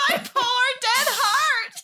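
high, slow, laughing wail; laughing lament. Acted. Zombie laughing over her leaked dead photos.

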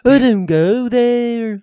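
high, slow, drawling caricature. Acted. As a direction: drag the words out in a high put-on voice. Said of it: "Oh, no, that's [name]".

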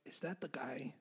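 low whispered wonder; hushed, breath-only aside; drunken hushed question. Acted. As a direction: whisper it under your breath, half disbelieving. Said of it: "and you're like".

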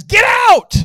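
explosive high yell; angry leader shouting. Retold or imitated. Frustrated leader to the students, loud angry shout. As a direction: order them out at full volume.

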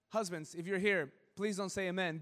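normal talking voice

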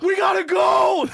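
high, loud mock shriek of panic; panicked. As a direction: imitate a friend in full alarm.

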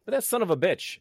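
loud, high joking indignation; mock outrage. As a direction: yell it in fake fury. Mock outrage at co-host's joke.

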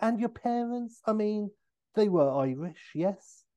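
high, probing mock politeness; Austin Powers. Austin asking O'Brien about his parents, delicately probing. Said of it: "He's like".

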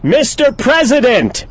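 loud drawn-out call; shouting to be heard. Acted. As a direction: shout it across the range. Third call, unanswered.

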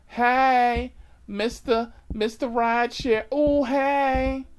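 high, loud campy call; flamboyant hailing. Retold or imitated. Passenger calling out to the rideshare driver, flamboyant high shout. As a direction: hail your driver in an excited falsetto.

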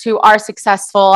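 ordinary delivery

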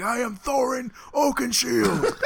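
laughing, slow, high heroic declaration; hammed-up laughing battle cry; drunken Thorin shout. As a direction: boom it out like a drunk dwarf king. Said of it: "and I'm like".

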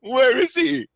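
high, loud panicked calling for the cat; frantic search. Acted. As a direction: call out in a panic for the lost cat. Owner frantically looking for cat.

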